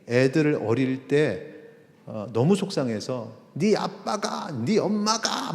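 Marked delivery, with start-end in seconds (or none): 3.5-5.5 much higher, a half-mocking upset cry; blaming the other parent in front of the child; upset parent outburst. Upset parent complaining to the child about the spouse, high mock outburst. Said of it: "막 이렇게 되면은".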